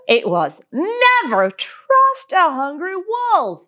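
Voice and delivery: plain reading voice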